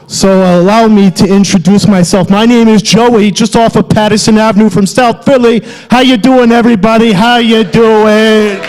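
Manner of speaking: hammed-up Philly alter ego; thick South Philly accent. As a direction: play up the South Philly guy. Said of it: "exaggerating it for comedic effect".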